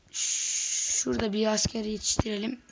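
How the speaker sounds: usual speaking voice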